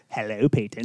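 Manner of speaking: low, soft creepy greeting; a hint of Hannibal Lecter. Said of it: "Silence of the Lambs".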